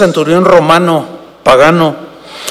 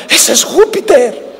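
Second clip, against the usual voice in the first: awed crowd voice; 8 semitones higher than usual. Retold or imitated. Crowd naming Bernabé a god.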